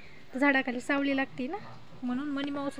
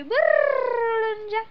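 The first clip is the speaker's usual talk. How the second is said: long rolled trill, high and loud; playful flutter-away call; 9 semitones higher, 7 dB louder, much slower than usual. Pretend. Mother playing birds flying off for baby, trilled sing-song. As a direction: roll the r like wings taking off, stretched out. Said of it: "Chivla बोलव".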